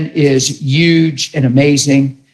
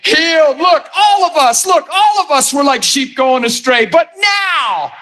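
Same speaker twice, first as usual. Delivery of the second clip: high, shouted revival preaching; preaching at full force; 8 semitones higher than usual. Real, not acted. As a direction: shout it to the whole church.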